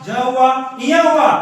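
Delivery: normal talking voice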